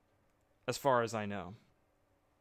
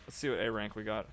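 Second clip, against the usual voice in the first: usual speaking voice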